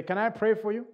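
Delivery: usual speaking voice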